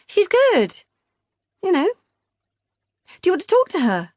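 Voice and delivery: high, lilting sister voice; high sing-song sister. A narrator's reading. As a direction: chirp it brightly, high up. Beth voiced on phone.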